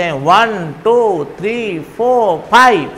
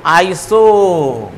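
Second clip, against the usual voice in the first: very slow stretched iso; drawn out; much slower than usual. Shown as a demonstration. Teacher stretching the prefix so students remember it. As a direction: hold each sound long. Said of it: "नाम के पहले क्या जुड़ेगा".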